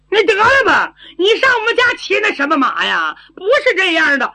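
put-on high, loud silly-girl protest; simple-minded girl, objecting. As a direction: object loudly in a high, stubborn voice. (傻丫头高声反驳)

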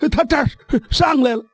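plain reading voice

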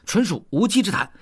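hastily chiming in; hurried agreement. A narrator's reading. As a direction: agree in a hurry, pitch lifted. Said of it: "德宝也赶紧说".

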